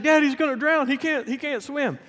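high-pitched mock alarm of a son; imitated panic. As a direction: cry out to your dad in exaggerated worry. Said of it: "man, he's freaking out".

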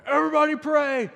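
high, loud hollered command; yelling. Retold or imitated. Son running round the house, hollering high and loud. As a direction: holler it over the room. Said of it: "He's yelling, screaming, hollering".

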